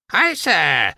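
very high, drawn-out bird call; whistled greeting. A narrator's reading. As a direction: sing it out like a chirpy magpie. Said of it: "fløjtede Pinky".

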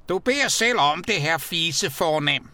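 high, loud angry yell; skrigende. A narrator's reading. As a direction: yell a challenge, pitched well up. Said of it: "og skreg". (skriger vredt)

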